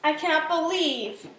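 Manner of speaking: loud, exasperated motherly scolding. A narrator's reading. Mama scolds kitty over drawings on the wall, scolding. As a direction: tell the child off loudly. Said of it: "she scolded".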